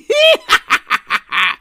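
high, loud cackling laugh; raucous laughter. Acted. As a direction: howl with mocking laughter. Mocking laughter. (harsány röhögés)

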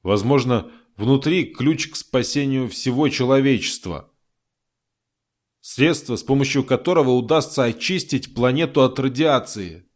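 usual speaking voice

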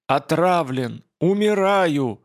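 hoarse, dying wheeze, slow and strained; wheezing croak. Acted. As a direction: choke out the words as if poisoned. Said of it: "прохрипел". (хриплый стон отравленного)

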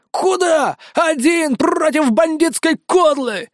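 high, slow panicked squawk; frightened clucking parrot. A narrator's reading. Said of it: "испуганно закудахтал Карамба". (испуганно кудахчущий попугай, высоко)

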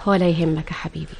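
low, soft and soothing; tender reassurance. Acted. Wife comforting husband about her daughters, tender. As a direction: soothe your beloved gently.

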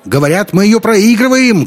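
very high, loud heckle; high-pitched yell over the crowd; shouting from afar. A narrator's reading. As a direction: call out from the back rows. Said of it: "крикнул кто-то в задних рядах".

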